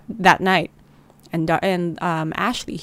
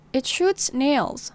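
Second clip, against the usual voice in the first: slowly, a touch higher; hesitant child voice; slow childlike answer; 5 semitones higher, much slower than usual. Acted. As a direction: spell it out like a scared kid.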